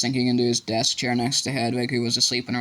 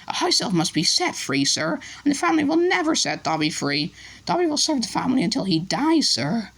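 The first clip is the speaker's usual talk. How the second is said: much higher, resigned elf; 12 semitones higher than usual. A narrator's reading. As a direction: elfo rassegnato, voce acuta.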